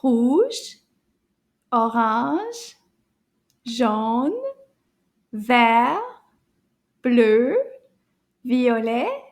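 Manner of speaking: each French colour word, "Rouge", "orange", "jaune", "vert", "bleu", "violet", "rose", "blanc", "noir", said slowly and clearly on its own; teaching pronunciation. Shown as a demonstration. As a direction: pronounce "Rouge" to "noir" one by one, clearly, for children to repeat. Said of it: "Can you repeat the colors after me?".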